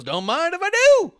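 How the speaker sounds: high, loud hammed-up wrestler voice; mock wrestler line. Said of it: "And he goes".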